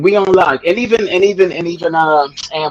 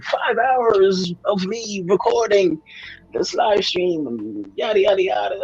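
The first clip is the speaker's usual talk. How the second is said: high-pitched voice imitation; 10 semitones higher than usual. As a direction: squeak it out like the little streamer. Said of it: "in his, uh, high-pitched voice".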